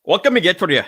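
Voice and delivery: loud, high Jamaican attempt that sounds Scottish; mock Jamaican accent. Shown as a demonstration. Friend repeats the greeting for the storyteller, mock Jamaican accent. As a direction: punch out a badly faked Jamaican accent. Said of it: "sounded just more like Scottish".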